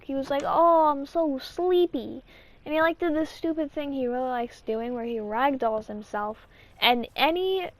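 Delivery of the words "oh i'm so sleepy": slow, drawn-out mock sleepiness; joking sleepiness. Little brother joking about sleepy gummies, slow mock-sleepy voice. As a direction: drag it out as a sleepy joke. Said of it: "Zach was making a joke".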